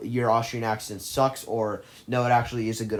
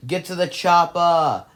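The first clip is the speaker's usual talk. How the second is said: put-on loud, higher, slow Austrian-accented bark; Arnold Austrian accent; 7 semitones higher, 7 dB louder than usual. Movie fan quoting the action hero's line, loud Austrian accent. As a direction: belt out the one-liner in a hammy Austrian accent. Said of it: "Is my Austrian accent as bad as it sounds?".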